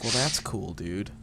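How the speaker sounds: quiet, dropping low at the end; understated, low mock-cool reply; deadpan retold reply. Retelling a reply to being shot, quiet mock cool. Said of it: "It's like".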